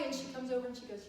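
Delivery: normal talking voice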